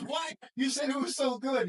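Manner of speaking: a squeaky, incredulous question; surprised what. Retold or imitated. The boy scolding his parents, high surprised disbelief. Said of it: "He goes".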